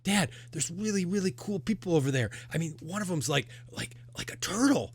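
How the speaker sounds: put-on high-pitched kid gushing to Dad; excited little girl. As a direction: burst out in a high child's voice. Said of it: "the little girl".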